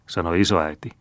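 ordinary delivery

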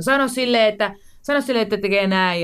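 retold call from upstairs. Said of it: "esikoinen huusi ylhäältä".

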